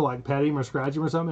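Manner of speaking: normal talking voice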